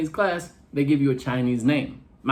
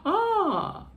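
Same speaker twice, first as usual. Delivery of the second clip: high disbelief. Retold or imitated. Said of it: "she said".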